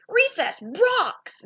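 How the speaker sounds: high, slow bellow. A narrator's reading. Clark bellowing about recess. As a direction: shout it long across the playground. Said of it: "bellowed Clark the Shark".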